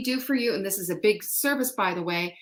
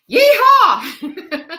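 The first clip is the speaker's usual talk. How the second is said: big high whooping cowboy yell, much louder; yeehaw cheer; 13 semitones higher, 13 dB louder than usual. Real, not acted. Host whoops to her live viewers, loud excited cowboy yell. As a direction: let out a loud rodeo whoop.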